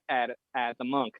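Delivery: usual speaking voice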